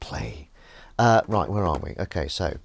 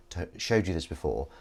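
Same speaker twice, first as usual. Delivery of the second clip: usual speaking voice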